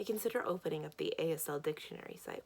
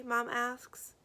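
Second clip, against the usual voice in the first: ordinary delivery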